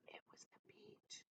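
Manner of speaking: breathy mock whisper. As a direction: whisper it like a secret joke. Repeated joke whisper.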